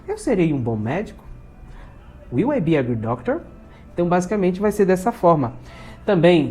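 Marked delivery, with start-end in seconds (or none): 2.3-3.4 "Will I be a good doctor" rising on DOCTOR; question intonation. Shown as a demonstration. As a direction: demonstrate the question, lifting the final "doctor". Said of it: "E faço a entonação".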